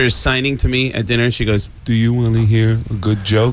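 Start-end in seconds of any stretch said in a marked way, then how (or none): 1.8-3.5 lightly voiced deaf sister; a hint of his sister's deaf speech; mock deaf sister asking; impression of deaf sister. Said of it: "I'm doing an impression of my sister".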